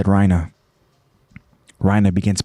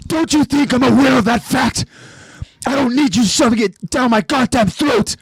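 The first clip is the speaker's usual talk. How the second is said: bursting out; 12 semitones higher than usual. Acted. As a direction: snap back, stung and furious. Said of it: "Rhina suddenly bursts".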